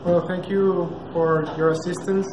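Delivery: ordinary talk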